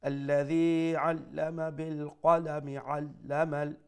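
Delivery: slow chant joining "بالقلم" to "علم" as qalami-allama; measured connected "بالقلم علم"; continuous recitation. Shown as a demonstration. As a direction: voice the i after "بالقلم" and read on. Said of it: "পড়িয়ে আমরা continue করবো".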